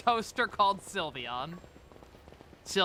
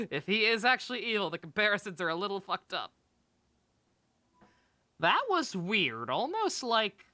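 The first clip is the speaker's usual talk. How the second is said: ordinary talk, nothing marked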